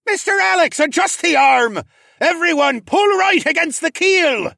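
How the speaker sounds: high, loud roar of orders; full-force shouted orders. A narrator's reading. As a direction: roar commands like a captain in a hurricane. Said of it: "the raccoon man roared".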